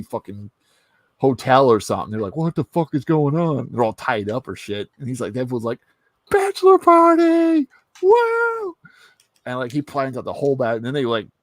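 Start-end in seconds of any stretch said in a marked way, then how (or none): 2.3-3.7 higher-pitched mock alarm; alarmed confusion. Friends waking up tied up in a hotel, alarmed confusion. Groggy victims wake tied up. 6.3-8.8 very high, loud, drawn-out cheer; gleeful party whoop. Acted. Deadpool to the tied-up heroes, gleeful party whoop.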